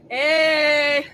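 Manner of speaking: a bright loud shouted Hey; loud greeting. Acted. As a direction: call out Hey with a big voice.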